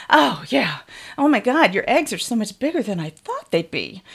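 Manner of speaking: normal talking voice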